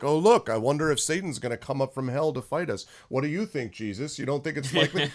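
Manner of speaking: obnoxious, sarcastic taunting; God. Acted. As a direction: sneer at the enemy with fake wonder. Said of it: "basically mocks him".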